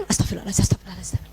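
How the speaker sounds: soft breathy whisper of istighfar. Shown as a demonstration. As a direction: whisper it while the child looks down. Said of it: "pas dia nunduk, astagfirullah". (istighfar berbisik pelan)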